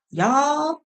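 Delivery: high, drawn-out y'all; playful y'all. Shown as a demonstration. To viewers, showing her gender-neutral y'all, high and playful. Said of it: "the gender neutral".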